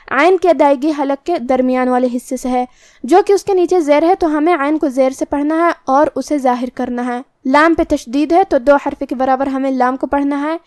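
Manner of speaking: ordinary talk, nothing marked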